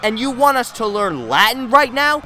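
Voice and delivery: high, loud exasperated rant; outraged. Real, not acted. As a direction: vent your disbelief at the teacher.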